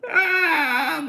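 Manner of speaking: surprised cry. Real, not acted. Reacts to chat comment.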